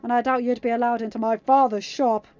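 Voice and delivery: loud, forceful interrogation; snarled warning. A narrator's reading. Uma interrogating the captive, snarled. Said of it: "Uma snarled".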